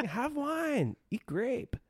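high-pitched mock-Greek accent; Russell Crowe. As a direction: coax like a merry Greek god. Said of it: "full Greek accent".

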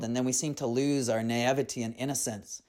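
ordinary delivery, nothing marked

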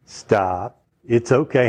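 hushing shh, then a low, soothing stop; calming a baby. Acted. Preacher voicing Jesus calming frightened disciples, soothing hush. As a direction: hush them as you would a crying baby. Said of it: "calmed down a crying baby".

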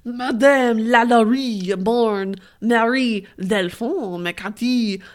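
loud, slow, hammed-up French accent; grandly overdone French accent. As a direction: declaim it in an exaggerated French accent. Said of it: "do it with a French accent".

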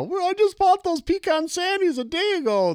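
high mock mom voice; mom, exasperated. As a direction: squeak it out like a fed-up mom. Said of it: "They're just like".

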